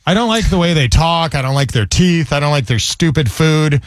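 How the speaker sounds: ordinary delivery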